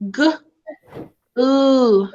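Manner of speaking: normal talking voice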